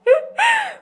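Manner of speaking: squealing laugh burst; high squealing laugh. Real, not acted. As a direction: burst into a shrill giggle. Laughs at own joke.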